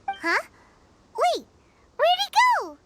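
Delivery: startled to find him gone; surprised. Acted. Companion suddenly vanished.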